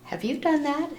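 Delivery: ordinary delivery, nothing marked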